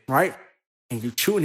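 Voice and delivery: usual speaking voice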